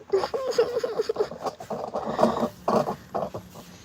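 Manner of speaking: high, breathy, long nervous laughter; long frightened giggle about the spider; breathy scared laugh. Real, not acted. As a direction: laugh it out in fear.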